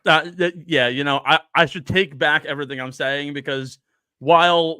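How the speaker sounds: usual speaking voice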